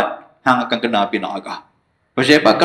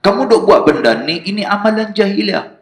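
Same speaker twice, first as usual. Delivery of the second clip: higher, forceful sermon voice; fiery preaching; 8 semitones higher than usual. Acted. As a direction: drive the warning home to the congregation. (ceramah berapi-api dengan nada tinggi)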